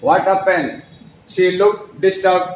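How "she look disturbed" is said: very slow, word by word; slow classroom dictation; slow model sentence. Shown as a demonstration. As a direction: drill it slowly for students. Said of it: "नहीं, वह परेशान लग रही है".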